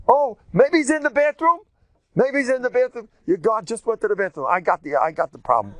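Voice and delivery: high, loud sarcastic mockery; mock discovery. Eliyahu jeering that Baal is in the bathroom, high and loud. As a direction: pretend you've solved it.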